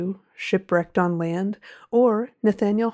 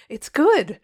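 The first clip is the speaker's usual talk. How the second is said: higher-pitched, lightly acted excited exclamation; excited cry; 6 semitones higher, 4 dB louder than usual. A narrator's reading. Young writer handing over his first story, excited cry. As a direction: announce it with a burst of excitement, pitch jumping up. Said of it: "his face flushed with excitement, and cried".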